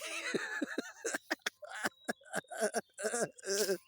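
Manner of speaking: long, loud, high laughing fit; helpless high laughter; big real laugh. Real, not acted. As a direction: crack up at your own story. Laughs at own retelling.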